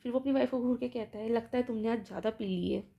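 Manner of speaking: normal talking voice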